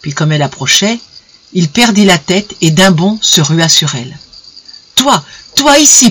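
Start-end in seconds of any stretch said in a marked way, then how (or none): 4.9-6.1 higher, outraged outburst; sudden high, heated attack; spat-out accusation. A narrator's reading. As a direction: hurl it at her in disbelief. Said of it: "proféra-t-il".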